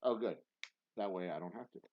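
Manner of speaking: usual speaking voice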